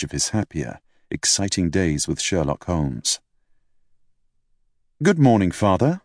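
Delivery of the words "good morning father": cheerily. A narrator's reading. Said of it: "I said cheerily".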